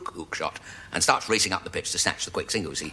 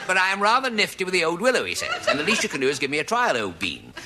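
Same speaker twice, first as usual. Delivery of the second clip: posh horse; 7 semitones higher than usual. Acted. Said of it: "a bit posh".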